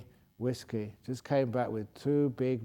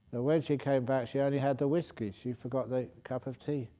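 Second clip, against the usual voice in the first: ordinary talk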